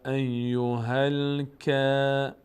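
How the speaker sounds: ایوهل کافرون drawn out, کا kept light; slow recited reading. Shown as a demonstration. Teacher adding the next word, slow and light.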